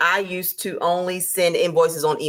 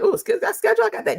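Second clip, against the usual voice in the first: much higher, teasing ooh of a listener who thinks they've got it; mock know-it-all; faster than usual. Teacher mimicking a viewer who thinks they know, high mock ooh. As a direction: squeal it like you've already got this covered. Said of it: "I know you're like".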